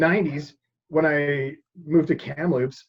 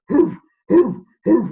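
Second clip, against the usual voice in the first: hoof hoof hoof, a booming bark, pitched higher and louder; mock deep dog bark; 8 semitones higher, 9 dB louder, slower than usual. Retelling a walk, imitating the dog's bark loudly. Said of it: "this, like, really deep bark".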